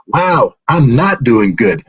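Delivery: drawn-out slow denial; slow rueful correction. Retold or imitated. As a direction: slowly set them straight. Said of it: "And I'm like".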